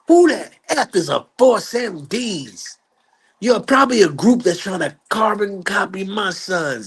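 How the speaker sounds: high-pitched indignant rant; no filter tirade. Retold or imitated. Joe Jackson berating the band's manager in his office, no filter. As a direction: imitate a hostile man tearing into visitors. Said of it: "Joe just didn't care. No filter".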